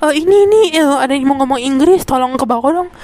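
imitated flustered call for help; mock panic. Panicked staffer phoning a colleague for help with English, mock panic. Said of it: "Dia panik, cuy".

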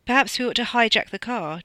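offhand and lordly; casual suggestion. A narrator's reading. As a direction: toss it out casually. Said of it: "Dono said casually".

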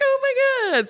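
high squealed oh my God, lightly mocking; imitating excited girl. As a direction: play the thrilled girl, way up high.

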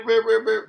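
loud high beep-beep; imitated beeping. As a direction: shout beep beep beep.

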